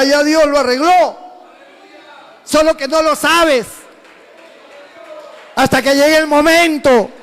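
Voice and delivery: loud sermon cry; preaching at full force. Acted. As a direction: preach it out to the brothers and sisters.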